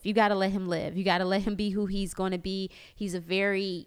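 ordinary talk, nothing marked

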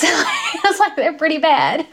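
high, laughing retelling. Real, not acted. Patient admitting her scans are bad, laughing. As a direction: laugh through the admission.

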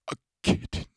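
breathless hushed whisper of kitten. Retold or imitated. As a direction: breathe kitten with hungry awe. Renfield craving a kitten.

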